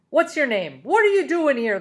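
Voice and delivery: loud, high angry holler; furious foreman yelling. A narrator's reading. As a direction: bark it at the kid from the truck. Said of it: "the foreman hollered".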